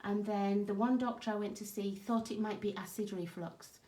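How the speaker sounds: ordinary talk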